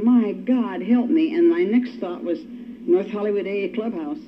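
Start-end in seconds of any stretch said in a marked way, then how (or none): none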